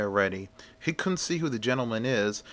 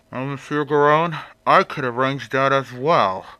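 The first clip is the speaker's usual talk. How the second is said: louder, sinister stranger voice; menacing stranger voice; 3 semitones higher, 5 dB louder than usual. Acted. Figure's menacing line before the killing.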